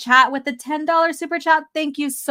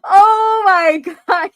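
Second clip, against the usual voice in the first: high and loud through laughter; teary laughing exclamation; laughing hard; 8 semitones higher, 7 dB louder, much slower than usual. Real, not acted. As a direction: crack up at the super chat. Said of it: "I'm, like, in tears right now".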